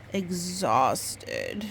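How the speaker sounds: usual speaking voice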